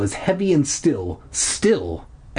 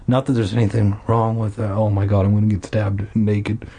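ordinary delivery

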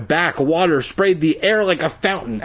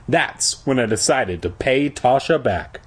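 ordinary delivery

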